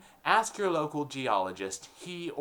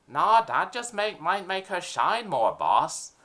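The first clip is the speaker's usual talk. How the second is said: higher, louder goon voice; eager dim henchman; mafia henchman; 5 semitones higher, 4 dB louder, slower than usual. Acted. Henchman questioning the boss, higher and louder.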